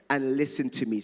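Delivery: measured, deliberate Bible reading; scripture reading. Acted. Pastor reading John ten to the congregation, measured. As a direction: read the passage with weight.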